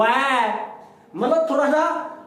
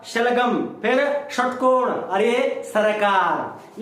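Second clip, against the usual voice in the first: slow demo; much slower than usual. Shown as a demonstration. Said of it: "इस प्रकार से बोलना अपन".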